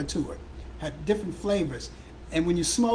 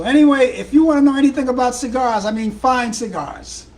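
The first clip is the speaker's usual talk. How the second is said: much louder, higher pitchman voice slowing on "fine cigars"; hammed-up ad pitch; 7 semitones higher, 11 dB louder than usual. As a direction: boom it like a radio promo. Big ad plug for cigars.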